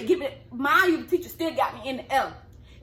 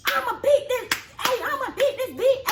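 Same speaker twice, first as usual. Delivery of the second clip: high-pitched mock scream of a threat; imitated screaming; 10 semitones higher than usual. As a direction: imitate someone screaming mid-fight, pitched way up. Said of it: "she started screaming".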